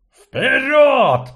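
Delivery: high, loud inhuman howl of a priest. Acted. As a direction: howl the command like something not human. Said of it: "взвыл жрец нечеловеческим голосом".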